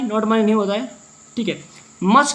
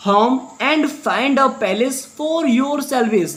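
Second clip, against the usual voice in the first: drags each word out, very slow; very slow reading aloud; 2 semitones higher, much slower than usual. Shown as a demonstration. Teacher reading the line to students, very slowly. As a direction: dictate it word by word.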